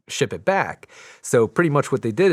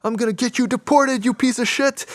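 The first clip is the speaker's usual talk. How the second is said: high, louder mock rage; hammed-up furious yelling at the valet; imitated screaming; 13 semitones higher, 3 dB louder than usual. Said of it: "Ephraim's, like, screaming".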